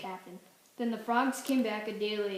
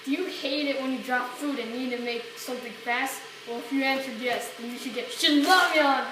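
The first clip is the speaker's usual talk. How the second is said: ad read; 7 dB louder than usual. Acted.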